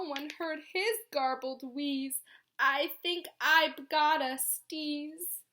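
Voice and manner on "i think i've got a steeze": slow, stuffy sneeze-talk; stuffed-up voice. Acted. The hog announces a sneeze, slowly and stuffy. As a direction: say it through a blocked nose. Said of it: "Now he's all stuffed up".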